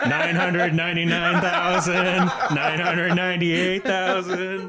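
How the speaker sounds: mock laborious countdown; slow counting aloud. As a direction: count out each number at a crawl. Mimicking slow counting out loud.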